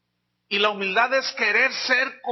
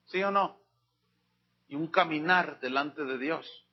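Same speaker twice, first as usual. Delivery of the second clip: ordinary delivery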